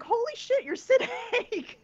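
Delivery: high, awestruck blurt; a fan suddenly face to face with his idol; starstruck exclamation. Retold or imitated.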